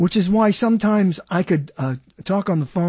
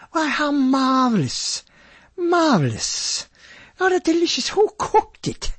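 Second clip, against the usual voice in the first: high, slow, hammed-up posh Brit praising food; snobby British voice; 8 semitones higher than usual. Shown as a demonstration. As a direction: sing out the compliment like a snobby Englishman. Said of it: "with that voice".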